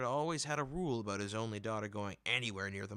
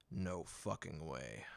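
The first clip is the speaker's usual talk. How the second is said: low, slow, final refusal; stern father; 6 semitones lower, 6 dB quieter, slower than usual. Acted. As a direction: lay down the law like a gruff father. Father's flat rule quoted.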